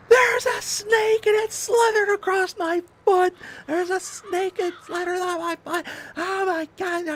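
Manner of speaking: high-pitched hysterical shrieking; hammed-up terror losing all control; screaming panic. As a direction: shriek it, loud at first, then frantic. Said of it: "I'm just screaming".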